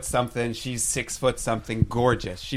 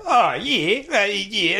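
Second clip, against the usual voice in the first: lightly higher, hammed-up Kiwi accent; mock Kiwi accent; 6 semitones higher, much slower than usual. Teasing his boss Ange at the bar with a mock Kiwi accent. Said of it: "And I'm like".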